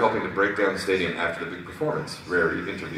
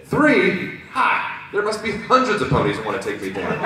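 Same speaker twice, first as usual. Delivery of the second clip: high, loud scoff; cocky disbelief; 13 semitones higher, 6 dB louder than usual. Acted. Rainbow Dash boasting of enemies, cocky and incredulous. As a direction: scoff at the small number.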